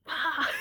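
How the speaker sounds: sharp high cry of pain, loud; mock labour cry, high and loud. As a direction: let out a sudden yelp as if it burns. Reliving the ring of fire.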